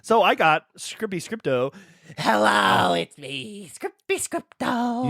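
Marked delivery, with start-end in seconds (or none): 2.2-5.1 slow, strange demonic voice; creaky hell creature voice. Pretend. Voicing a demonic script character.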